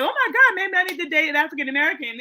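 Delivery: high, fast laughing gush; excited laugh. Retold or imitated. The lady reacting to the husband story, high laughing gush. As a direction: squeal it out giggling. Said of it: "she was like".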